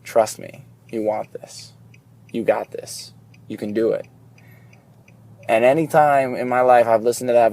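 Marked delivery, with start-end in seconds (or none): 0.0-4.1 much softer, near whisper, calm and sure; quiet inner voice. Retold or imitated. The heart's quiet peace telling him to go for it, softly. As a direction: voice a gentle inner voice urging you on. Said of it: "there's a quiet peace that says".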